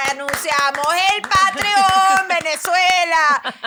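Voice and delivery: slow loud announcer voice; grand announcer. Pretend. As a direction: boom it out like a stadium host. Joins announcer bit.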